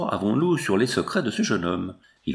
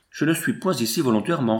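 ordinary delivery, nothing marked

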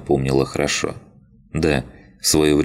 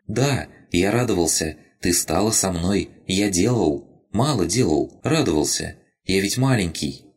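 higher, small and plaintive creature voice; childlike rabbit spirit; 5 semitones higher than usual. Acted. Crystal rabbit tells Lina it was glad, higher childlike voice. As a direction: speak like a tiny lost spirit. Rabbit voice held across lines.

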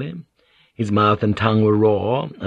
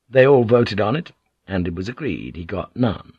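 plain reading voice

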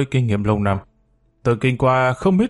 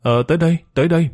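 higher, flustered stammered reply; 6 semitones higher, faster than usual. A narrator's reading. Flustered Mỹ Mỹ answering the knock at the door. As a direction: caught off guard, calling out nervously. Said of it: "ú ớ trả lời".

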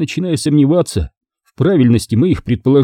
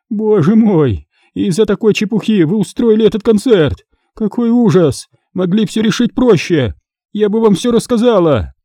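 high-pitched, aggrieved lament; exasperated captive woman; 9 semitones higher than usual. Acted. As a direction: complain to the abductors, pitched up high.

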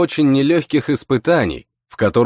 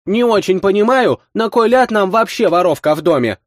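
indignant woman; 9 semitones higher than usual. A narrator's reading. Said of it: "подбоченившись, возразила Васька".

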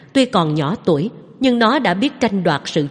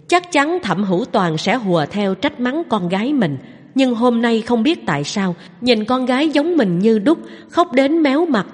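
ordinary delivery, nothing marked

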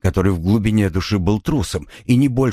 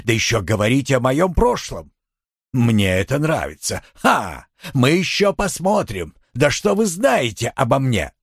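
indignant blustering husband; high, defiant outburst; agitated Solberg voice; 6 semitones higher than usual. Acted.

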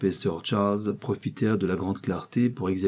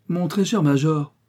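high-pitched call from a lady; lady's raised, insistent voice; calling out loudly; 7 semitones higher, 4 dB louder than usual. A narrator's reading. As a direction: call out to your husband across the table. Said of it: "cria la dame de sa plus grosse voix et la bouche pleine".

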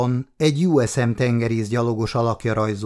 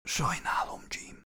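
breathless dying whisper; 13 dB quieter than usual. A narrator's reading. Dying woman's last words to Jim, whispered. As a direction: fade out in a whisper. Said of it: "suttogta Ashraf".

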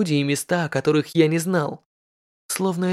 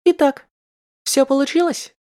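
high, eager noblewoman; impatient high lady asking; lady in red; 9 semitones higher, 4 dB louder than usual. Acted. (нетерпеливая знатная дама)

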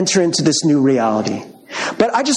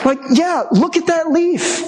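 awed wonder; 8 semitones higher, slower than usual. Acted. Wonder at leaf, high and slow.

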